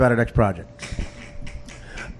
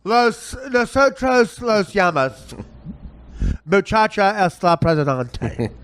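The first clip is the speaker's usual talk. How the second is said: high, slow effeminate voice with mock Spanish; hammed-up effeminate fake Spanish, high and slow; Tim Kaine impression; 6 semitones higher, slower than usual. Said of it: "I'm perfecting this".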